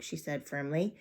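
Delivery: plain reading voice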